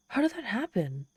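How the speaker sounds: shocked. Acted. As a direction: pitch jumps up in disbelief. Demon learns all empires fell.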